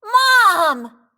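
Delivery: loud high call for mom; crying out. A narrator's reading. Clark calls his mother about his ruined cap, a loud high yell. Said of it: "cried Clark".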